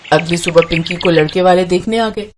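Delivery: usual speaking voice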